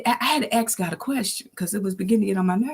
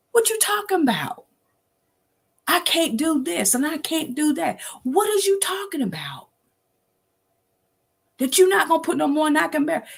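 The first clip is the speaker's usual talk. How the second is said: much higher, incredulous whine at God; exasperated questioning; 9 semitones higher than usual. Retold or imitated. Repeatedly asking God, high and incredulous. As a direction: demand an answer in disbelief. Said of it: "I had to ask him repeatedly".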